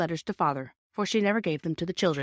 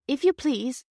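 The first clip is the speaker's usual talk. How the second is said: put-on timid girl's plea; 8 semitones higher, 4 dB louder, slower than usual. A narrator's reading. Timid call to the engine driver.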